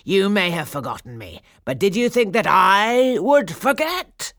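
loud villainess rising to a high screech; cackling wicked fairy. A narrator's reading. Wicked fairy taunts those who forgot her, building to shriek. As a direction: build from menace to shrieking triumph. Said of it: "cackled the wicked fairy".

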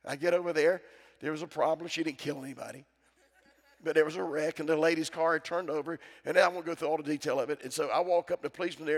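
ordinary delivery

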